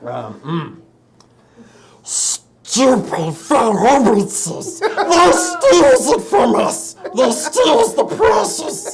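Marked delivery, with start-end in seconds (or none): 2.0-8.9 high, slow, strained creature voice; Gollum voice. Acted. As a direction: hiss it out like a creepy hobbit-thing. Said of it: "Gollum from Lord of the Rings".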